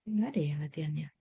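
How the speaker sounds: quick, hushed half-whisper; soft, rapid self-directed question; muttered aside to self. Real, not acted. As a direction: mutter it quickly under your breath while checking. Checking for exercises, talking to herself.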